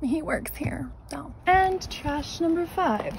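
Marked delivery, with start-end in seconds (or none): 0.0-1.3 unvoiced whispered aside. Real, not acted. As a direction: whisper it behind his back. Aside about the worker.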